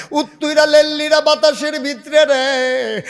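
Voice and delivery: high, loud sermon wail; impassioned preaching cry. Real, not acted. Preacher's impassioned chant about cold wind.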